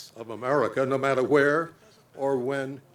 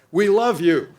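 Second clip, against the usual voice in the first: louder and higher, a voice ringing out across the crowd; called out from the crowd; 4 semitones higher, 6 dB louder than usual. Retold or imitated. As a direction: call it out to the veteran on stage. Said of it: "cried out".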